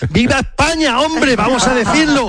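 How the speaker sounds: high-pitched patriotic cry; exultant cheer. Real, not acted. Pundit rallying the studio after beating France, exultant cheer. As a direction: cheer for Spain, voice leaping way up. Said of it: "hay que decirlo lo más alto posible".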